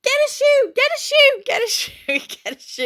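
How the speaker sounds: shrieking high and loud, fading out at the end; panicked scream. Retold or imitated. Mother shrieking at her daughter over the cockroach, panicked scream. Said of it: "And I started screaming".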